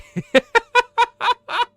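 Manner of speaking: real high, almost crying laugh; helpless laughter. Real, not acted. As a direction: burst into high-pitched, tearful laughter. Laughing over crying with another viewer.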